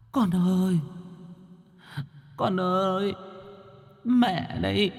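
high, soft ghostly mother's wail; thin, high-pitched mournful keening; tearful wailing. A narrator's reading. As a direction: draw it out, pleading tearfully for your child. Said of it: "mà mếu máo".

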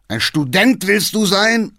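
high, loud, stretched-out bellow; scornful roar at the son; enraged shout. A narrator's reading. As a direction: yell it with furious scorn. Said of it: "schrie der Alte".

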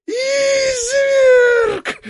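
squeal. A narrator's reading. Sukhomyatkin squealing at the coachman. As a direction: shriek the insult. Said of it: "визжит Сухомяткин".

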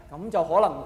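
ordinary delivery

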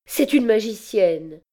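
high, distressed outburst; pained accusation. A narrator's reading. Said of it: "balbutia-t-elle douloureusement".

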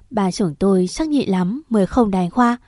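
ordinary delivery, nothing marked

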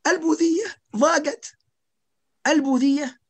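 high, laughing "البوذية"; laughing in disbelief. Real, not acted. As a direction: laugh out the word in scornful disbelief. Laughs at the idea. (ضحكة استنكار ساخرة)

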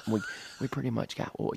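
halting whispered protest; imitating hushed wife. Voicing wife's hushed phone protest.

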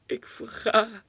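anguished cry. Acted. Poem's anguished climax.

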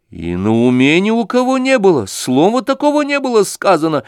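громко и выше обычного; горячо возразить; вскричала. A narrator's reading. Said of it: "вскричала Александра Ивановна". (Александра громко возражает)

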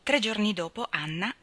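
ordinary delivery, nothing marked

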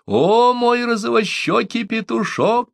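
very high, slow, teasing exclamation; gleeful cry. A narrator's reading. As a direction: cry out, stretching the endearment. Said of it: "крикнула Омаата". (радостный, дразнящий крик)

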